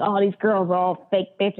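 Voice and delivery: lightly put-on Canadian-Boston accent; Canadian-Boston blend. Complaining regular caller, a hint of a Canadian-Boston accent. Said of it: "He'd like".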